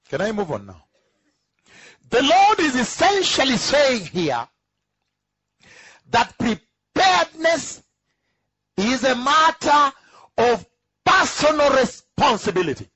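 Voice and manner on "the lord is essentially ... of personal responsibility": high, slow, loud preaching on "preparedness"; emphatic preaching. Acted. Preacher proclaiming to the church, slow and emphatic. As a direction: stretch "preparedness" up high, then hammer "personal responsibility".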